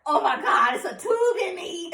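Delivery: mock panic, rising high; retold freak-out. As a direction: draw it out in acted horror, on the edge of laughing. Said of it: "and I freaked out".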